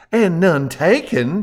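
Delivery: high, slow Southern belle drawl; Scarlett O'Hara drawl. A narrator's reading. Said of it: "replied in the Scarlet O'Hara".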